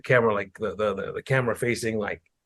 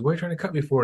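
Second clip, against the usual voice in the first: higher-pitched indignant question, mock teen; retold protest; 7 semitones higher, faster than usual. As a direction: imitate a kid protesting in a higher voice. Voicing the threatened teen, retelling video.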